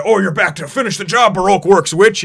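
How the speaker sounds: loud, high, hammed-up confrontation; mock angry accusation. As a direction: pounce on the intruder with an exaggerated accusation. Crew surrounds and accuses stowaway.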